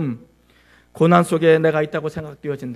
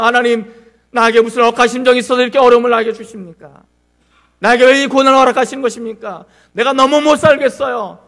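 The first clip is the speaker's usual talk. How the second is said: loud, higher protesting plea; complaining to God; 5 semitones higher, 9 dB louder than usual. Acted. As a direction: demand answers from God in a loud voice. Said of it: "이렇게 따질 때가 많죠". (하나님께 큰 소리로 따지듯)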